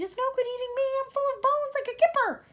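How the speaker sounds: high, loud, cheeky bug voice; centipede shouting. Acted. Centipede shouting to the Cloudman that he is all bones. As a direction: brag loudly about being bony. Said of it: "shouted the centipede".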